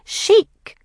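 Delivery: very high, loud, knowing little pronouncement; wise verdict. A narrator's reading. Mercedes judges the outfit for her sisters, wisely. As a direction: pronounce it like a tiny fashion expert. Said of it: "says Mercedes wisely".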